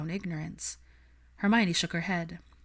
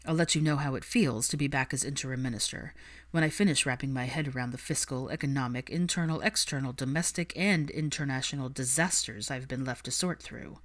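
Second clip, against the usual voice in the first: a touch lower, dry ministerial weariness; lightly lowered voice, tired of the mess; Draco, dry and weary; 4 semitones lower than usual. Acted.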